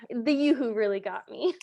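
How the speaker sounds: ordinary talk, nothing marked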